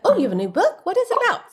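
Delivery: lightly imitated Bronx accent, lifting high at the end; curious Bronx guy, only hinted accent. Bank representative asking her about her book, light Bronx accent. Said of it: "a guy with a heavy Bronx accent".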